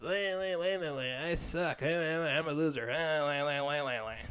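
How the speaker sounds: babbling mockery of own inner voice; mock self-pity. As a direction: babble like a hopeless self-declared loser. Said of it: "mentally just".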